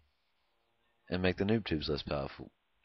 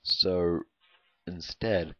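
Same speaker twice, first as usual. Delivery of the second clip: normal talking voice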